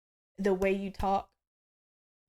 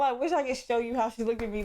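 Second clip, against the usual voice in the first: ordinary talk, nothing marked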